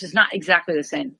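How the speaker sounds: ordinary delivery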